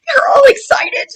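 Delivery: high excited cry. Real, not acted. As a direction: squeal it with delight.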